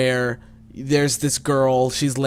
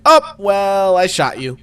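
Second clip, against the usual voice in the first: loud, high drawn-out "oh" easing down; mock casual John; 7 semitones higher, 8 dB louder, slower than usual. Retelling John's lunchroom reaction, mock casual and loud. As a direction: imitate a friend shrugging it off, loudly. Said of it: "and John's like".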